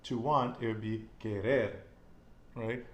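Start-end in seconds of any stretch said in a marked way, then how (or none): 1.2-1.7 "querer" stressed on the last syllable "rer"; final-syllable stress. Shown as a demonstration. Teacher contrasting the infinitive, final stress on "querer". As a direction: land firmly on "rer" in "querer". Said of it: "stress is always on the end".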